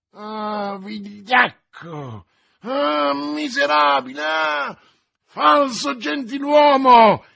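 loud, high shouted insults; crying out. A narrator's reading. D'Artagnan chases the fleeing stranger, crying out. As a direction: hurl each insult at full voice, drawn out. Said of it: "gridò D'Artagnan".

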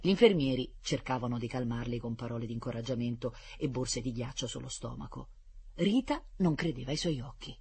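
plain reading voice

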